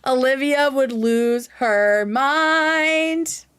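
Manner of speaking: slowly stretched, ending on a long "mind"; lingering, savouring each word; slow drawn-out repeat. Real, not acted. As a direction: draw every word out for effect.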